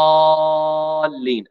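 الضالين drawn out slowly with an open a; open vowel. Shown as a demonstration. Teacher modelling the correct word slowly. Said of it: "ونفتح الفم".

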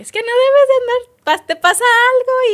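voz mucho más aguda y fuerte, imitando el regaño; regaño imitado. Retold or imitated. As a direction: imita burlona a quien la regaña. (imitación aguda y chillona del regaño)

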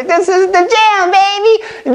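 high, slow mock kid voice. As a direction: imitate a little kid loving the tape. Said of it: "they gonna say".

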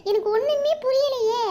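wailing shock, slowing at the end; stunned wife. Acted. Wife hearing of husband's second marriage, high shocked wail.

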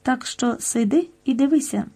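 ordinary delivery

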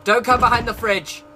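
hammed-up armed homeowner; mock threatening shout. Bluffing at an intruder.

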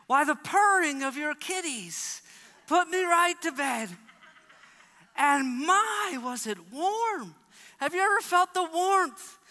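high, loud, gushing cheerful account; cheery Daniel. Acted. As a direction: gloat cheerfully about the cozy night. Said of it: "He said".

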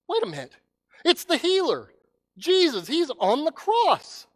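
sudden high gasp of recognition, slowing on cross; shocked realization. Acted. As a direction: let the shock hit you as you see him. Listener realises who is crucified.

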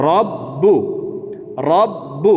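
রব্বু with a long held double b; doubled ba. Shown as a demonstration. Teacher drills রব্বু for learners, b pronounced twice. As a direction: hold the b in রব্বু, then release বু. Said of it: "আরেকবার এই বাটি নিজের আরেকটি উচ্চারণ হবে".

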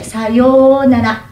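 sayonara, slowly, with the long syllables in the middle drawn out; long vowels. Shown as a demonstration. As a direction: say sayonara stretching its middle sounds. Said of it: "Tem sílabas longas no meio". (sayonara devagar, alongando as sílabas do meio)

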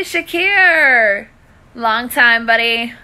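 ordinary talk, nothing marked

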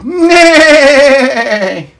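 high neighing nay; whinny like a horse. Acted.

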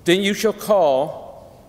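normal talking voice

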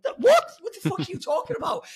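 high-pitched, baffled retort; retold disbelief, squeaking upward; incredulous retort. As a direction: shoot the pitch way up on a stunned "what?". Retells baffled reply to odd remark.

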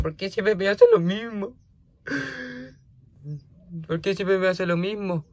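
high, plaintive complaint; exasperated whine. Real, not acted. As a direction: whine at a pet that keeps misbehaving. Complaining at runaway pet. (queja aguda y exasperada)

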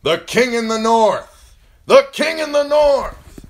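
very loud, high, drawn-out chant; shouted acclamation. A narrator's reading. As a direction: shout it out, ringing from the timbers. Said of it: "shouting the old words".

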